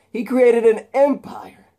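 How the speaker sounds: high, loud, slow outburst; slow loud high declaration; shouted emphasis. Acted. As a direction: bellow it slowly. Harry's repeated line, louder.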